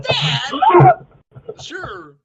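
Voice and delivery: high, loud, slow cartoon voice; goofy cartoon voice. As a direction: ham up a Goofy-style bit.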